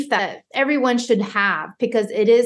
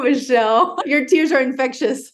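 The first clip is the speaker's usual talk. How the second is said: ordinary delivery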